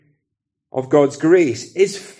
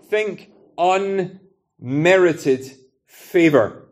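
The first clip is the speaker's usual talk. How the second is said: very slow, word by word; deliberate, heavily spaced emphasis; emphatic restatement; much slower than usual. Real, not acted. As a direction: spell out the key phrase slowly, pausing between words. Preacher restates key definition for emphasis.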